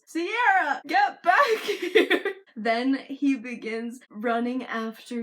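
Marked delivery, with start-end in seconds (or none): 0.0-2.2 hammed-up high, loud yell with a laugh. Kendall calling Sierra back while sprayed with the hose, mock yelling. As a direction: yell it loud and high, stretching the last words. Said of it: "Kendall yells".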